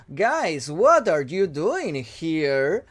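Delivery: very slow, Borat-like English accent; heavily marked accent. Shown as a demonstration. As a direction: overdo an odd foreign accent, stretching each word. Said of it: "lo digo como muy marcado".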